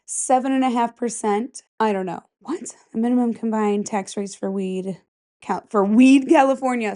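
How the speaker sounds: usual speaking voice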